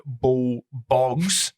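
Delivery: "bull bogs" slowly, leaning on each B; stress on the B. Real, not acted. Host reads out the discount code, stressing the B in "bull bogs". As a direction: punch the B sounds in "bull bogs". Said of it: "Emphasis on the B".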